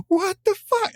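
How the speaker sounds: very high-pitched mock freakout; Joker meltdown. Fan retells Joker seeing Batman unmasked, high mock meltdown. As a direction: imitate a villain losing it completely. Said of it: "has this total fucking meltdown".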